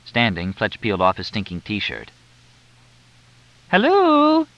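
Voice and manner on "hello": a high, loud hello called across the garden; calling loudly. Acted. A woman hails the distant gardener, loud and high. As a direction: call it out to someone far away down the slope. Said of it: "Missus Haybeck called loudly".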